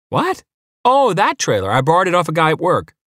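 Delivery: high-pitched, surprised and breezy figure; cheerful neighbour. A narrator's reading. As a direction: squeak the surprise, then explain cheerfully up high. Cheerful neighbour caught off guard.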